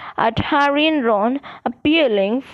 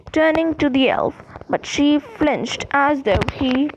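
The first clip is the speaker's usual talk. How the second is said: plain reading voice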